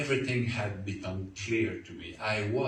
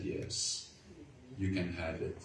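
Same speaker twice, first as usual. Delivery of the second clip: softer, half-whispered yes through tears; tearful surrender; 5 semitones lower, 8 dB quieter than usual. Retold or imitated. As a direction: give in softly, weeping a little. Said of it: "And I cried".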